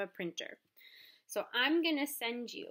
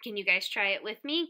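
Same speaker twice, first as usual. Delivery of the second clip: ordinary talk, nothing marked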